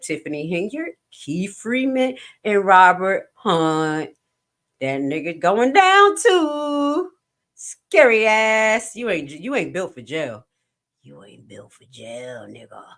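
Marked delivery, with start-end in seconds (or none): 4.8-8.9 slow, loud drawn-out jeer; gloating slow taunt. Acted. Mocking defendant. 8.9-10.5 taunting. Acted. Taunting defendant. 11.0-12.8 quiet taunt. Acted.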